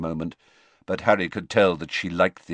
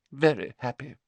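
higher-pitched, mildly sniffy reply; 6 semitones higher than usual. A narrator's reading. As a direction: answer with a touch of disdain. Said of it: "said Professor Trelawney sniffily".

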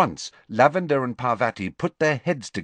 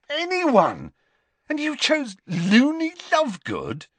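high-pitched, incredulous outburst; can't believe the choice, voice pitched right up; disbelief; 4 dB louder than usual. A narrator's reading. Ron questioning his friend over dinner, in high disbelief. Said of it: "said Ron in disbelief".

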